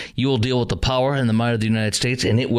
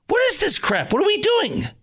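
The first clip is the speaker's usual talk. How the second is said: outraged disbelief. Real, not acted. Commentator outraged at the swap for hostages, voice soaring. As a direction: pitch shoots way up in disbelief.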